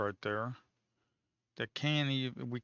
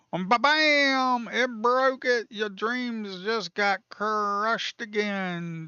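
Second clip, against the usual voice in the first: high, loud, slow taunt; gloating ba-bam; 9 semitones higher, 6 dB louder, much slower than usual. Real, not acted. Trader taunting shorts after the break, gloating. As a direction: drag out the gloat.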